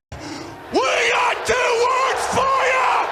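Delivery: very high, slow wrestling shout. Retold or imitated. Quoting D X at Elvis haters, as a very high, slow arena shout. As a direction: bellow it out to the arena crowd. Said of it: "to quote D X".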